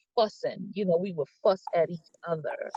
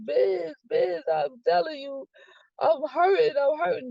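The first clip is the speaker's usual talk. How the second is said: high, pleading voice, reliving the hurt; retold crying plea; 7 semitones higher than usual. Telling Biz on the phone she's hurting, high pleading. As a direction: beg him through remembered tears. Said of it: "and I'm crying".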